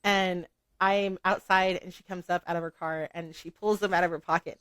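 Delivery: usual speaking voice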